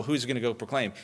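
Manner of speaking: normal talking voice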